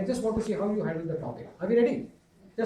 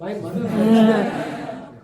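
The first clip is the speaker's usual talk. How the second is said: laughing; 3 semitones higher, 12 dB louder, much slower than usual. Real, not acted. Correcting student, laughing at pun.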